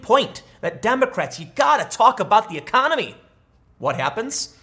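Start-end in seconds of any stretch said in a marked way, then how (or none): none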